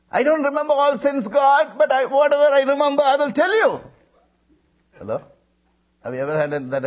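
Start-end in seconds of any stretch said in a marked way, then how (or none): 0.1-3.8 high, loud, urgent plea to God; fervent acted prayer, sustained at high pitch; crying out in prayer. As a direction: pour out the confession in one raised rush. Retold desperate prayer of confession.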